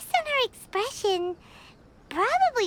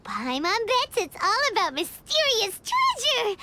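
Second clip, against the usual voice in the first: normal talking voice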